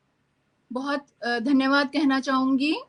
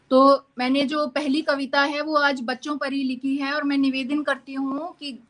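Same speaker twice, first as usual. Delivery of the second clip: usual speaking voice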